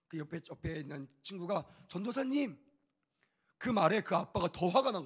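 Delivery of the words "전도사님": high, eager call of the pastor's title; a child's sudden cry for help at the door. Retold or imitated. Boy calls out to the pastor in front of his father, eager call.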